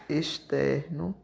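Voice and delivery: normal talking voice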